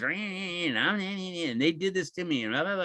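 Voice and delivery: whiny complainer. Shown as a demonstration. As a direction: moan like a self-pitying victim. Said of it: "Complaining is".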